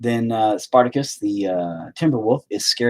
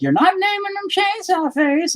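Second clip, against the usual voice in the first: much higher, put-on nagging wife; mock wife voice. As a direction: squeak it like a scolding wife. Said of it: "They're like".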